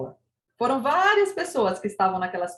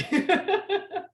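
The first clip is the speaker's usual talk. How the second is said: high laughter; bright laughing burst; 9 semitones higher than usual. Real, not acted. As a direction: laugh at the joke. Laughing about teasing Eric.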